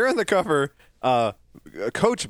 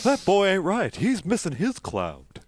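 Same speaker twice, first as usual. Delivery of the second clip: Hank Hill; 5 semitones higher than usual. Retold or imitated. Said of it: "sound like a vampire".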